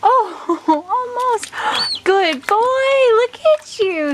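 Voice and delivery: excited pet-parent cheering; delighted praise. Real, not acted.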